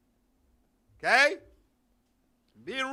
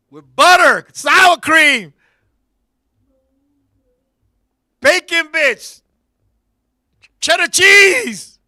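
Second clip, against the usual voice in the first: louder, building to slow drawn-out cheese; loud mock sales pitch; 3 semitones higher, 11 dB louder, slower than usual. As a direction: hype up each topping slowly. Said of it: "She showed me".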